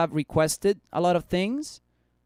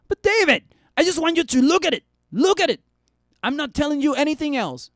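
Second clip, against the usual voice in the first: pushy viewer; 8 semitones higher, 3 dB louder than usual. Acted. Voicing viewer pushing to look.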